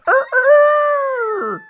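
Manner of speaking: high, loud crowing; rooster crow. Acted. Cheerful rooster crowing down at the fleeing robber. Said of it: "he called out".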